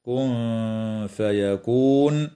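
كن فيكون with the ن hidden in a light nasal hum before the ف. Shown as a demonstration. Teacher shows students the hidden nun in كن فيكون. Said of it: "إخفاء حقيقي مع الغنة".

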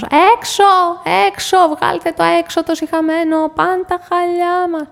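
high, loud cry to throw the dog out; a housewife screaming over her carpets; ξέσπασμα φωνής, οργισμένη κραυγή; outraged shriek. Acted. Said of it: "η φωνή της κυρίας Βασιωτάκη".

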